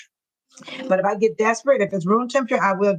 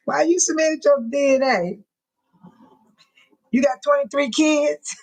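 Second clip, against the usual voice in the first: laughing; 5 semitones higher than usual. Real, not acted. Laughing reaction to a DNA comment.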